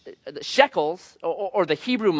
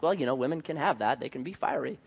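soft dismissive aside; 3 semitones lower, 8 dB quieter than usual. Retold or imitated. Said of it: "I'm like".

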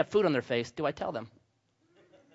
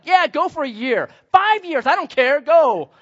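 mock careless girlfriend; 10 semitones higher, 9 dB louder, slower than usual. Imagined indifferent girlfriend voice.

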